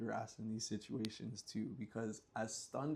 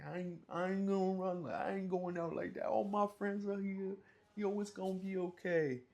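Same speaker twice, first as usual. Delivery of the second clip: mock bravado; 9 semitones higher, 3 dB louder than usual. Bragging warrior bravado.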